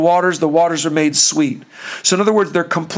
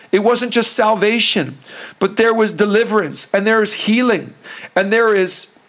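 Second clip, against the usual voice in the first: normal talking voice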